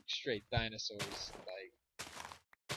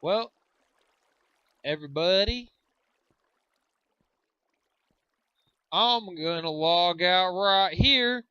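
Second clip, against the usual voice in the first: announcer voice; 6 semitones higher, 13 dB louder, slower than usual. Pretend. Announcing sign-off.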